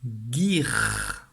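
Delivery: guir said slowly and clearly, hard g; teacher dictates the syllable guir, slow and clear; dictation syllable. Shown as a demonstration. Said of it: "Tu vas écrire la syllabe".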